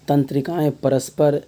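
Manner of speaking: normal talking voice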